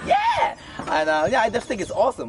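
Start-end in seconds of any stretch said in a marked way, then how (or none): 0.1-0.5 high, loud burst of cheering; excited shout. Real, not acted. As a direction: whoop it out with delight. Cheering friend's praise of [name].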